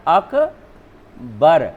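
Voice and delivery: अकबर broken slowly into ak, pause, bar; split at dropped vowel. Shown as a demonstration. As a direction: pause after the closed syllable अक in अकबर. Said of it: "मेरा लोप हो रहा है". (अकबर, अक और बर अलग-अलग)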